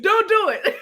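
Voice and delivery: playful warning. Real, not acted. Joking warning to listener.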